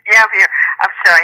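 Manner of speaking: panting after running, out of breath. Real, not acted. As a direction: speak while catching your breath. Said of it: "I had to catch my breath".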